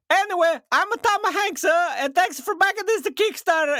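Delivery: very high Mario-style fake Italian; pseudo-Italian accent. Cohost quoting his own video sign-off in a high Mario-style accent. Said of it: "like, Mario pseudo-Italian".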